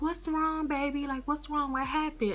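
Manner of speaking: high, worried and caring; concerned owner's wife. Retold or imitated. Owner's wife asking an upset customer what's wrong, high and caring. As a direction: fuss over her like a worried auntie. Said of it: "So she was like".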